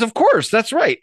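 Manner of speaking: high-pitched, emphatic agreement; emphatic confirmation. Acted. Bartender answering the dog's repeated questions, emphatic. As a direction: confirm what should be obvious.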